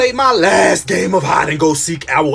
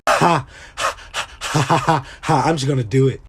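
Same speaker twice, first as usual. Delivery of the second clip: huffing creepy laugh; 4 semitones lower, 4 dB quieter than usual. Retold or imitated. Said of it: "that's a lot of H sounds".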